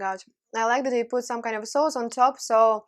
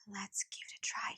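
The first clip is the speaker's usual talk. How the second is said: soft whisper; hushed, close to the mic; 3 semitones lower, 13 dB quieter than usual. Real, not acted. Whispered before tasting.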